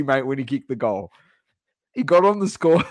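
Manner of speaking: ordinary talk, nothing marked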